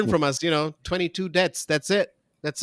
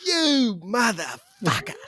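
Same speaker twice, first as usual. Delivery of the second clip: slow, higher mock rage; mock curse; 8 semitones higher, slower than usual. Imagining Ardern shouting at incompetence, slow mock fury. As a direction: lean on every syllable in fake fury. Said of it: "just saying".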